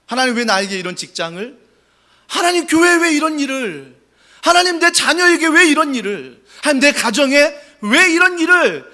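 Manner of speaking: complaining to God. Acted. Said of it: "제일 먼저 하는 게 불평입니다".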